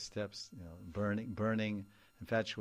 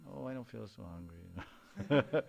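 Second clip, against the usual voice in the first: lovesick sigh; 9 dB louder, slower than usual. Retold or imitated. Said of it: "You fall in love".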